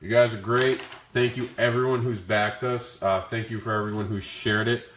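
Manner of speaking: ordinary talk, nothing marked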